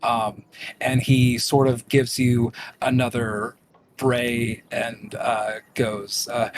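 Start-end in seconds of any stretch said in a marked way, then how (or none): none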